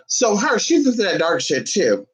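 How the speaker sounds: ordinary delivery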